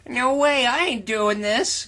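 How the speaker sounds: high, slow, mildly whiny refusal; a touch of panicky Chris. Acted. Reading Chris refusing to sink, in a lightly whiny voice. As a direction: dig in like a scared kid. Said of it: "Chris called".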